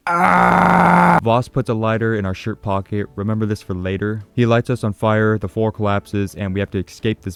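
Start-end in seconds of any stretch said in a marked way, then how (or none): 0.1-1.2 loud high mock scream of rage; villain's yell. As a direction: let out a hammed-up villain yell. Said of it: "He has some badass dialogue".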